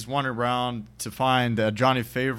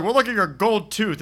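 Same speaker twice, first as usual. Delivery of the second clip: mock accusation; 9 semitones higher, 5 dB louder than usual. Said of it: "he's like".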